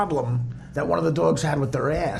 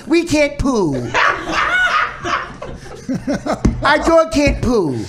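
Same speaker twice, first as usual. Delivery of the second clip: slow, higher mock baby talk; mock doggy voice; 7 semitones higher, slower than usual. Mocking Dan's dog talk, slow whiny baby voice. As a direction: whine it like a sad puppy. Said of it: "you hear Dan go".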